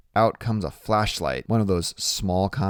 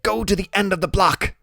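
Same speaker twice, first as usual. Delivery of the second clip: ordering; 11 semitones higher, 4 dB louder than usual. A narrator's reading. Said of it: "he orders".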